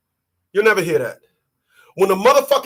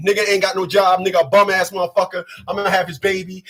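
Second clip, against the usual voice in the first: ordinary talk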